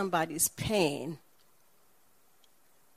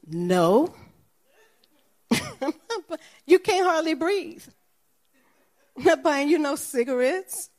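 loud, higher, scolding refusal; incredulous scolding no, raised voice; firm loud no; 7 semitones higher, 6 dB louder than usual. Retold or imitated. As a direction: shut the request down hard, half laughing. Refusing to buy cigarettes.

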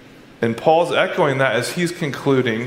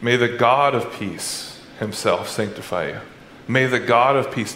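prayerful; 4 semitones lower than usual. Shown as a demonstration. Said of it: "instead of saying".

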